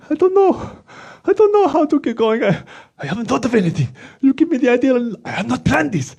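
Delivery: high, fluttery puppet panic; nervous puppet. Acted. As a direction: puppet losing his nerve.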